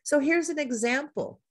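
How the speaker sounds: usual speaking voice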